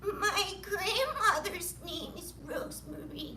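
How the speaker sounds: high, drawn-out mocking voice; put-on high-pitched teasing; teasing kids' nickname. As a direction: stretch it out in a high, silly taunt. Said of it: "I was also known as".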